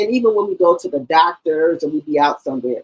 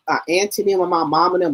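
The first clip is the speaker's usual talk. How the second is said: ordinary talk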